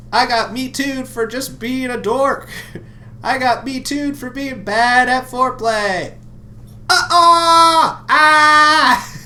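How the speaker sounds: high, loud comic impression; Aziz Ansari impression. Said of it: "a little Aziz Ansari humor".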